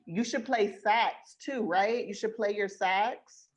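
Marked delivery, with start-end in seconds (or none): none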